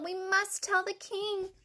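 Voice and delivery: high, slow chick voice; urgent but drawn out high; puffed plea. A narrator's reading. Chick pleading with the fox, lightly puffed. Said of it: "puffed Chicken Little".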